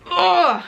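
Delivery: retold groan of frustration; exasperated groan. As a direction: let out a fed-up "ugh". Retelling exasperation at agent.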